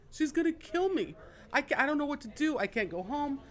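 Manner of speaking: high-pitched mock distress of a losing player; imitated tearful panic. Broke gambler to dealer about his wife, imitated tearful panic. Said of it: "So he started to cry".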